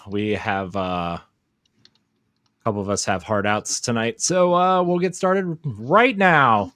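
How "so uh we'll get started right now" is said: high, slow, drawn-out announcer call. Acted. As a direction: stretch it out like a ring announcer. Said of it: "I'm doing my announcer voice".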